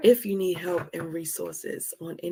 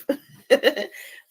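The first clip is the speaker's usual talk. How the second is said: loud, high burst of real laughter; 8 semitones higher, 8 dB louder than usual. Real, not acted. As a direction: laugh out loud while wrapping up. Laughs while wrapping up.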